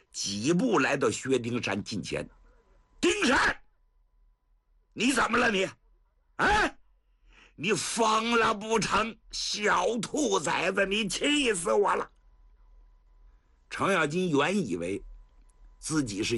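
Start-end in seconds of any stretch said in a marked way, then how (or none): 3.0-12.1 put-on furious old general. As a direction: blast the boy like an angry grandpa. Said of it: "老程气得胡子撅起多高". (气急败坏的高声怒斥)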